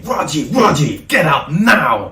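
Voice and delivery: furious, high, drawn-out shouted order; loud re-enacted scream, each word stretched; screaming a name. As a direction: yell it like an officer pounding on a door. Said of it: "He was screaming my name".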